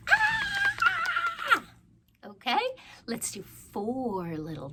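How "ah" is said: high playful shriek; mock scream. Storytime reader acting the knight's scream for kids. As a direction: shriek like a scared little knight.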